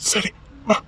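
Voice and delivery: whispered, resigned yes; hushed agreement. Acted. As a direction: whisper the consent. Man agreeing in a hush. (கிசுகிசுப்பான சம்மதம்)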